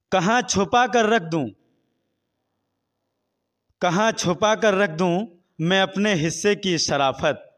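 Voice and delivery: projected, measured shayari delivery; poetry recitation. Real, not acted. Interviewee recites couplets on hunger and voting, projected recitation. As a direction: declaim the verse phrase by phrase, with pauses.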